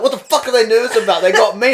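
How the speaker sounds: loud, high mock bravado; brash retort. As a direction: imitate a cocky dealer scoffing at their nerves. Crackhead retorts to the nervous girls.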